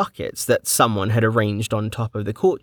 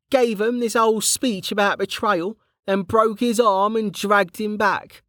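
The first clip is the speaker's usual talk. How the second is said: higher, rough-edged friendly soldier; Kettle's voice; 11 semitones higher than usual. A narrator's reading. Kettle greeting an old friend back from the dead, jovial. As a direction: play the cheeky old comrade, teasing.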